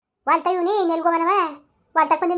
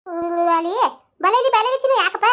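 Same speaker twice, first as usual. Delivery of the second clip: cooing; 5 semitones higher than usual. Acted. Cooing over crying baby.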